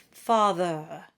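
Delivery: Father in a British accent, open ah. Shown as a demonstration. Teacher demonstrates the ah vowel with a British accent. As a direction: say Father the British way, forward and rounded. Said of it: "with a British accent, with a forward placement".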